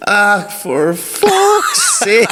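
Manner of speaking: high, very slow West Cork groan of a curse; mock West Cork exasperation. Friend imitating a farmer breaking the PTO shaft, slow high West Cork groan. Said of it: "all you hear was".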